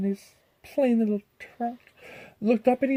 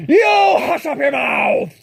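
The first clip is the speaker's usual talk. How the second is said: slow, loud, higher howl; 5 semitones higher, 8 dB louder, slower than usual. Acted. The king howls at Mac to hush, slow and loud. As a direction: howl him down with fury. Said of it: "howled the mighty King Yertle".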